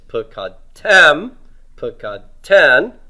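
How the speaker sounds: pukatem, pukaten slowly, with loud final TEM and TEN; stress on the ending. Shown as a demonstration. Teacher shows heavy endings, pukatem and pukaten slowly stressed on the last syllable. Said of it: "not stressing root two here".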